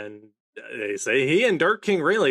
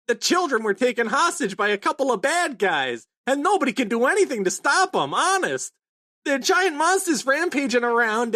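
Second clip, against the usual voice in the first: frantic Chopper; 11 semitones higher than usual. Retold or imitated. Chopper warning the crew about the hostages, high and frantic. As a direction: blurt out the danger, rushing.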